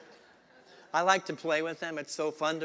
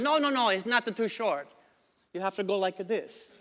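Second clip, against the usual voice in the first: higher-pitched tailor with a put-on accent; joke tailor; 4 semitones higher than usual. Tailor waving away a customer's complaint, higher-pitched comic protest. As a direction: play the tailor brushing off a complaint.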